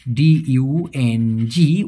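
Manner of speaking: very slow, letter by letter, কে এ এন ডি ইউ এন জি; spelled out slowly. Shown as a demonstration. Teacher spelling the Malay word for students, slow and deliberate. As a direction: spell each letter of কে এ এন ডি ইউ এন জি apart with long gaps. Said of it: "উচ্চারণটা হবে".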